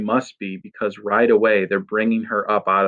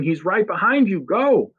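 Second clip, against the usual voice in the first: high urgent yell; panicked kid yelling a warning; 10 semitones higher than usual. Acted. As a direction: scream the warning, much higher. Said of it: "Freak is yelling".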